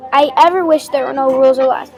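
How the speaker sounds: plain reading voice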